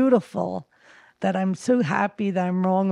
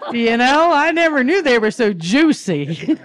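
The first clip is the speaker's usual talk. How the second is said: loud, high and cracking up; laughing delight; 5 semitones higher, 6 dB louder than usual. Real, not acted. Speaker joking about fruits of Jesus, laughing loudly. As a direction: push the joke out through laughter.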